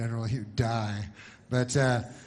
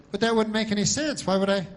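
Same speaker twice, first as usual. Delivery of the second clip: dog's reply; 9 semitones higher, 6 dB louder than usual. Acted. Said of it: "And then the dog goes".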